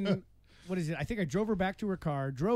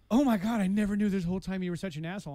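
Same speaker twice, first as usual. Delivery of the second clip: imitated outrage; 3 semitones higher, 5 dB louder than usual. Man voicing her reply in the breakup, imitated outrage. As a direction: burst out as the offended woman.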